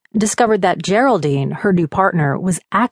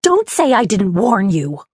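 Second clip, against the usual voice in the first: contemptuous; 3 semitones higher than usual. A narrator's reading. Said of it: "A look of contempt".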